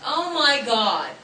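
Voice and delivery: loud, slow, drawn-out mock outrage; retold yell. Co-star calling out to her dad, mock yelling. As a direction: call it out high and loud, stretching the words. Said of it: "he yells to the top".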